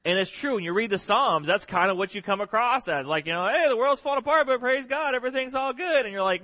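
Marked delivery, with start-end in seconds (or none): 3.5-6.1 high-pitched, louder mock cheerfulness; too-happy believer. Preacher mimicking the too-happy kid at Sunday school, high mock cheer. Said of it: "he's too happy about Jesus".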